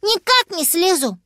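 put-on high, childlike refusal; little dragon. Little dragon up the tree to Papa, high-voiced refusal. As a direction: dig in like a stubborn little one.

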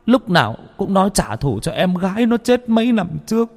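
put-on high, bitter elderly voice; old parent. A narrator's reading. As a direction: recount the warning with grief. Old parent recounts the call. (giọng người già cay đắng)

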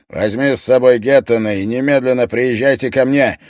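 loud, high barked order; commanding order. Acted. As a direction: order him loudly. Stoffe barking orders.

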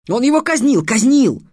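put-on boy accusing the dead king in class; heated accusation. A narrator's reading. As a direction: hammer the charge home.

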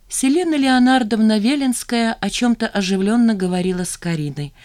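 ordinary delivery

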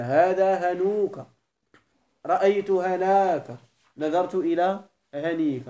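slow model phrases, long ū, ā, ī in "هنوك", "هناك", "هنيك"; full long-vowel form. Shown as a demonstration. Teacher recapping declension by letters, "هنوك", "هناك", "هنيك" stretched long. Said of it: "الإعراب بالحروف وهو المراد هنا".

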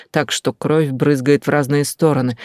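plain reading voice

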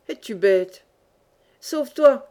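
urgent scolding, rising into a shout. A narrator's reading. Old miner ordering the boy to flee, shouting. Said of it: "cria le magister".

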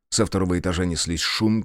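plain reading voice

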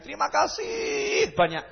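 a mock-polite thank you to the critic; exaggerated thanks. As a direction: lay the gratitude on thick and high.

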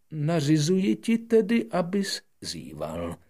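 high, loud, put-on royal voice; commanding king. King ordering the prince to yawn, high and loud. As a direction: decree it grandly. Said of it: "řekl král".

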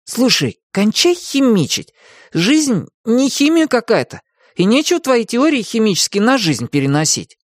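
put-on high-pitched boy cutting in; boy's impatient high voice; annoyed boy Tim. A narrator's reading. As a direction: voice a boy telling someone off, high.

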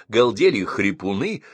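ordinary delivery

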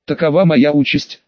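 ordinary delivery, nothing marked

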